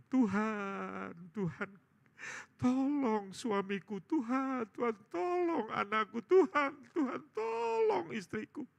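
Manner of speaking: high, drawn-out imitated wailing prayer; acted desperate plea. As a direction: cry out to God in mock anguish. Imitates bereaved relatives crying to God.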